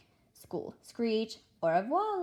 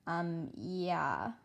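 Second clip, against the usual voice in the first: voice breaking. Acted. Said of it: "My voice breaks on".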